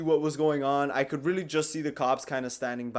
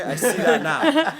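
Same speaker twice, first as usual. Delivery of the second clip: laughing; 9 semitones higher, 6 dB louder than usual. Real, not acted. Laughing confession.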